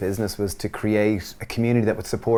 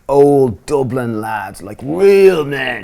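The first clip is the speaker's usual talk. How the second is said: drawn-out, louder send-up of Dublin lads; mock old Dublin lad; 4 semitones higher, 8 dB louder, much slower than usual. Twin describing the fruit market traders, mock macho. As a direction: stretch it out as a hint of a market hard man.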